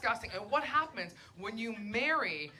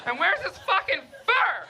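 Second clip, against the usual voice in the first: mock shocked outburst; 5 semitones higher than usual. Insulting friend's kid loudly.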